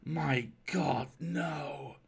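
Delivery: appalled stranger refusing; emphatic refusal. Acted. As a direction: press hard on "God", then let "no" sink. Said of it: "with extraordinary emphasis".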